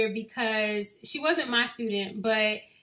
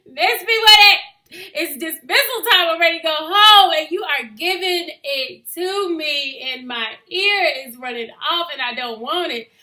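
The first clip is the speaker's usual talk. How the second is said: exasperated; 6 semitones higher, 11 dB louder than usual. Retold or imitated. Teacher's exasperated retold reply.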